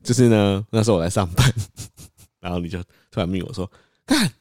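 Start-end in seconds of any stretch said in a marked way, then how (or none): 4.0-4.3 excited. Retold or imitated. Quoting friend's impatient texts.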